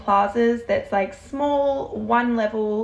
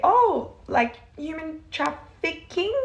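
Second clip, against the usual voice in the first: lighthearted question; 6 semitones higher, slower than usual. Retold or imitated. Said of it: "keep it lighthearted and give a little bit of a laugh".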